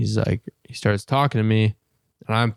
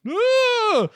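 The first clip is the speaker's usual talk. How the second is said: imitated girl's scream. Retelling what the neighbors would hear, high mock shriek. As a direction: yelp like a frightened little girl. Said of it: "little girl screams".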